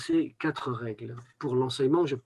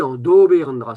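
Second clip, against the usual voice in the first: "en do" with the stress on "o", said louder; 8 dB louder, faster than usual. Shown as a demonstration. Teacher showing the correct stress in "en do". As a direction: lean on the "o" of "en do". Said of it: "en appuyant sur le o".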